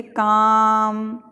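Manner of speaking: "काम" with long आ and no L sound; silent L. Shown as a demonstration. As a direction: stretch "काम" straight from vowel into M. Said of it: "एल को silent किया हमने". ("काम" में एल silent, आ लंबा)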